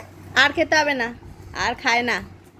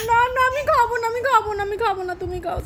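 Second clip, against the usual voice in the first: high mock refusal; 7 semitones higher, much faster than usual. Mother voices herself refusing, high.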